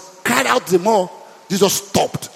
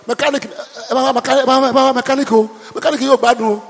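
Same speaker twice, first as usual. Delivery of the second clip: loud, high calling for the mechanic; 5 semitones higher, 4 dB louder than usual. Retold or imitated. Customer shouting for the mechanic over a bad car, loud. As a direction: holler to get his attention. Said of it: "what do I say?".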